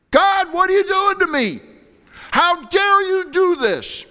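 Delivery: high, loud, slow anguished cry; anguished cry at God. Retold or imitated. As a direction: cry out to God in pain and anger. Hurting people screaming at God.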